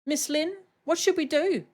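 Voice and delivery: a tiny helper fretting over a friend; little creature voice. Acted. Voices a character.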